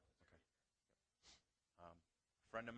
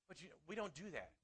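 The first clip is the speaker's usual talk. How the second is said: normal talking voice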